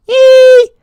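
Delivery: very high, very loud whoop; hyped shout; excited yelp. Real, not acted. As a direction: let out a sudden gleeful whoop. Hype cheer at sign-off.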